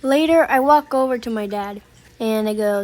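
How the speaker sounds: ordinary talk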